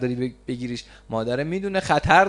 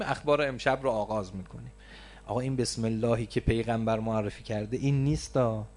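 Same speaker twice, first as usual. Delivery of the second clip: usual speaking voice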